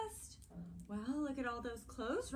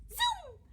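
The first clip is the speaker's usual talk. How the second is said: very high, loud zoom; quick high whoosh; zoom sound effect; 5 dB louder than usual. A narrator's reading. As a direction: toss out a swooping noise word. Story sound effect of dressing.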